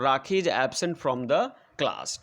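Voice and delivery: very slow, each word spaced out for the class; slow dictation. Shown as a demonstration. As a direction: read it out word by word as if dictating to pupils. Teacher dictating full sentence. (बहुत धीरे, शब्द-शब्द बोलकर लिखवाना)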